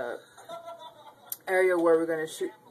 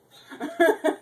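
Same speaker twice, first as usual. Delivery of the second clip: loud laugh; 10 semitones higher, 8 dB louder than usual. Real, not acted. Laughs at creepy door.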